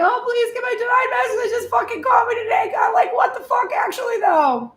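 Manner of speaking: high, loud, frantic plea to God; desperate begging prayer. Acted. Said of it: "Instead of praying that way".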